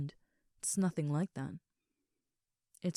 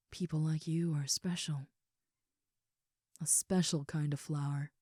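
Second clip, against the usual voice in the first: ordinary talk, nothing marked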